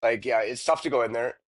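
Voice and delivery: lightly put-on Canadian accent; a hint of a jokey Canadian accent. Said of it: "I dropped the Canadian accent there".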